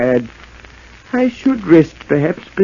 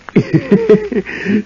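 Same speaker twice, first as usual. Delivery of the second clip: gleeful cackle; 6 semitones higher than usual. Acted. Madman proud of impersonations.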